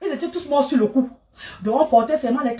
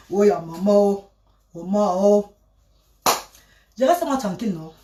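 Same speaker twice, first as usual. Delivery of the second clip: normal talking voice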